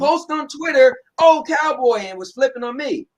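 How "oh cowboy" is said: loud, high sneering jab; mock the tweet with a sarcastic sting; mocking quote. Quoting the rival's taunting tweet, in a loud mocking tone.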